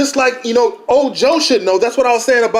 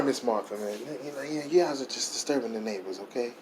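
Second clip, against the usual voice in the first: hushed apology; 12 semitones lower, 18 dB quieter than usual. Acted. Playing a soft-voiced neighbour.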